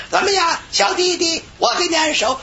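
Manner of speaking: put-on high-pitched foreign-accented Chinese; foreigner's Chinese accent. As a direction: imitate an Australian woman offering in accented Chinese. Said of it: "外国人会说几句中国话". (高声模仿外国老太太中文口音)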